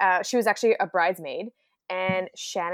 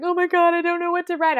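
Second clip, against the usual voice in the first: high, loud mock panic; panicked sister; 10 semitones higher, 5 dB louder than usual. Sister panicking over her wedding speech, mock squeal. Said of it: "Shannon's like".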